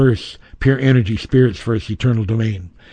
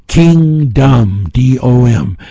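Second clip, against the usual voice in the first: normal talking voice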